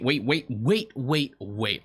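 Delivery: ordinary delivery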